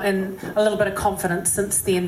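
ordinary talk

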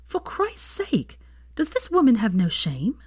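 exasperated, a touch of outrage; mild exasperated outburst. Acted. Hermione fumes to Draco about the article, mild exasperation. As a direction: throw up your hands at the gossip. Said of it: "she exclaimed".

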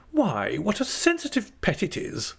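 high, fond exclamation; affectionate. A narrator's reading. Aunt bending over Dora, affectionate. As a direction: cry out fondly in a high voice. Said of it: "bending over her affectionately".

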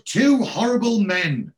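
loud, high, drawn-out mock cry; high, loud imitation of a screamer; retold screaming. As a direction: act out her horrified scream slowly. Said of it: "she screams".

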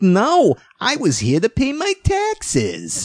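high, squeaky, drawn-out imitation; squeaky accented voice. Biker turning down an audition to pay taxes, squeaky mock voice. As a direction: squeak it out slowly, mimicking his accent. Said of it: "kind of a squeaky voice with kind of an accent".

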